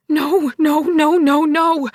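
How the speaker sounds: loud, panicked cry of no; shouting in alarm. A narrator's reading. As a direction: yell it as the door sticks. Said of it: "I shouted".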